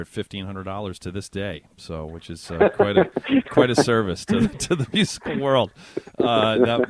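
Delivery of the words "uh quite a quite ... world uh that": laughing. Real, not acted. Host joking about low fee.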